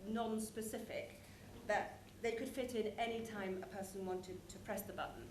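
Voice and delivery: ordinary talk, nothing marked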